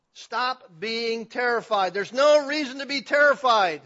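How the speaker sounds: loud slow preacher's command; loud, slow insistence. Shown as a demonstration. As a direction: drive home Stop being terrified. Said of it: "We could say it this way".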